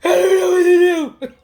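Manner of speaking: loud, high, drawn-out hammed-up sob; exaggerated crying retold with a laugh; mock wailing. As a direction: wail it like a comic breakdown. Retells breakdown at sister's at 3 AM.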